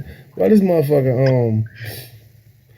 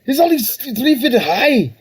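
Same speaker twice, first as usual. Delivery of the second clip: Scottish Commander Red; 10 semitones higher than usual. Acted. Imitating a commander lamenting a three-foot foe, high Scottish accent. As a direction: stretch it out in an exasperated mock-Scottish squeal. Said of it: "he was Scottish for some reason".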